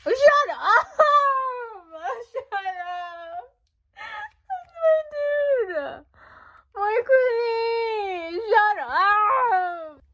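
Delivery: high, loud, laughing cries, stretched out; losing it over a favourite photo; excited shrieking. Real, not acted. Fan flipping the photo book, shrieking with excitement.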